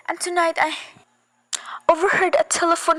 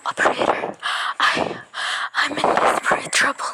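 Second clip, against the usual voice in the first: barely voiced plea to the operator; whisper, too scared to speak up; desperate whisper. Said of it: "I don't dare".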